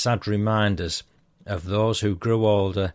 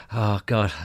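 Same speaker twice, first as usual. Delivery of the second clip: laughing at himself; 2 semitones higher, 5 dB louder than usual. Real, not acted. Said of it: "Two or three different accents in there".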